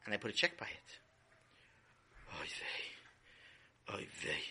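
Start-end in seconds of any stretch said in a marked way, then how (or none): 2.4-4.4 breathy whispered despair; exhausted whisper. Acted. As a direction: sigh the words out. Mother overwhelmed, sighing.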